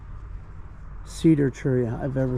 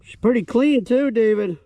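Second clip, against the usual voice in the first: excited; 7 semitones higher, 4 dB louder than usual. Real, not acted. Excited find of a clean coin.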